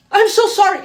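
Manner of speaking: high, loud, mock frightened sorry; panicked apology. Man in car apologising in English, mock panic. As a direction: imitate a scared man begging pardon.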